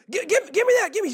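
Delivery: snatch the tiller in a hurry; frantic grab. Retold or imitated. Captain grabbing the tiller, high frantic voice. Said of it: "And he goes".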